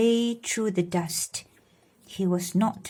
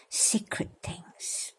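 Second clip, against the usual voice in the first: hushed secret whisper, slow; 12 dB quieter, slower than usual. Acted. Secret animal knowledge.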